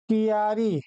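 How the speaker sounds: कियारी in three syllables, ki-yaa-ri; wrong pronunciation. Shown as a demonstration. Teacher shows pupils the misspelt word, कियारी said as ki-yaa-ri. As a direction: split the start of कियारी into ki-yaa. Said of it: "अशुद्ध है".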